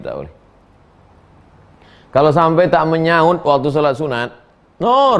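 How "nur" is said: high loud name call. Retold or imitated. Mother calling daughter who doesn't answer, loud call. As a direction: shout her name to summon her.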